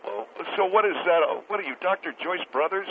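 usual speaking voice

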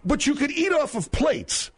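very high-pitched, incredulous retort; indignant high-pitched challenge; exasperated objection. Real, not acted. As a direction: push back in disbelief, voice way up. Host arguing with caller.

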